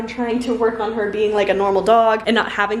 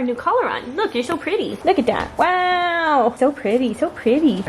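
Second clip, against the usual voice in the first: higher, cooing voice for the dog; puppy talk; 5 semitones higher than usual. Real, not acted. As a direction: coo it to a cute puppy. Cooing at the puppy's new collar.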